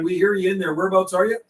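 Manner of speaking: ordinary talk, nothing marked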